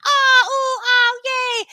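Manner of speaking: high, loud excited squeal; imitating cooing students. Students seeing the baby, imitated excited cooing. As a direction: mimic a class gushing over a baby. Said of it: "They're like".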